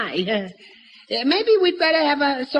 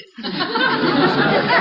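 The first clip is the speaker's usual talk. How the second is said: high laugh; 8 semitones higher than usual. Acted. Father laughs at Gorgeous Georgia.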